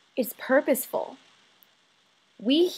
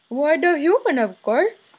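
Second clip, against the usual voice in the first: higher, louder put-on voice of a father; imitating father; 5 semitones higher, 4 dB louder than usual. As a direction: answer grandly in your dad's voice. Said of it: "of my father asking me".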